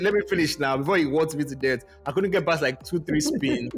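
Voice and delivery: ordinary talk, nothing marked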